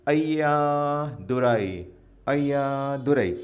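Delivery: drawn out very slowly; slow motion. Shown as a demonstration. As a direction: stretch every syllable of Ayyadurai out long. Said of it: "Ayyadurai को slow motion में बोलने की कोशिश".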